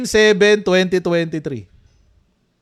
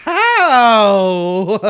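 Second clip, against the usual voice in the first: laughing disbelief; 7 dB louder than usual. Real, not acted. Mocking the hearing testimony.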